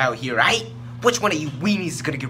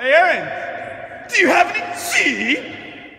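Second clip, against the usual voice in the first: shouting; 12 semitones higher than usual. Acted. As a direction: yell it out at full volume. Shouting to a friend.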